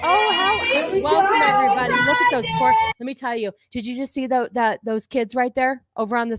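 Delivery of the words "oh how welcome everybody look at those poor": high, loud fake cheer; mock teacher welcome. Teacher welcoming pupils to pride day, mock high cheer. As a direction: greet everyone like an over-bright teacher. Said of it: "This is your teacher welcoming you in".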